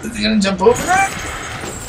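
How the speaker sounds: panicked. Real, not acted. Panicked as the monster approaches.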